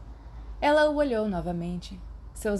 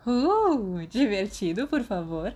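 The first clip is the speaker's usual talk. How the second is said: a purring, coaxing ask, drawn out on divertido; 3 semitones higher, 4 dB louder, slower than usual. Acted. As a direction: coax it out like a cat. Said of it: "Ela ronronou". (ronronando, manhosa)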